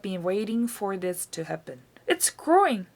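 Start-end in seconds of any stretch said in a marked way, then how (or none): 2.1-2.9 higher, louder thrilled cry; excited yell. A narrator's reading. Boy watching the hen grow, excited yell. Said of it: "he yelled".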